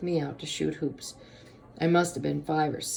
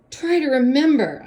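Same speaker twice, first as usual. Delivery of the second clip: high, loud plea; pleading; 9 semitones higher, 9 dB louder than usual. A narrator's reading. Boy begging his friend to remember a name, pleading. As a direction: beg urgently, voice pitched up. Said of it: "I pleaded".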